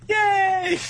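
high, loud mock squeal of delight; over-the-top gleeful yelp; excited cheer. Acting out a delighted character's reply.

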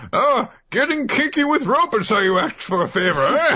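very high, mocking old man voice; old craftsman scoffing at a favor; Kaseki turning down. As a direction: tease like a cranky old craftsman. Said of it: "Kaseki's weird way of turning him down".

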